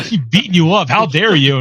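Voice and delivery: loud, indignant mock king; mock outrage. Mocking Richard's rage in the game, loud and indignant. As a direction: bellow it like an offended royal.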